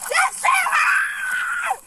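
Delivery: high, slow screaming in pain. Real, not acted. In pain while being restrained.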